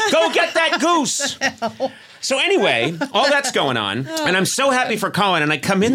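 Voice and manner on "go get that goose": very high, drawn-out command; slow, high mock-Dickens cry; excited Scrooge send-up. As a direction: send the boy off with hammed-up glee. Sends boy for the goose.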